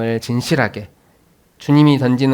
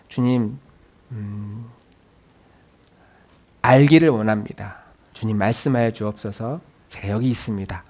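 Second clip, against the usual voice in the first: hushed prayer; 4 semitones lower, 5 dB quieter than usual. Retold or imitated. Believer praying to the Lord.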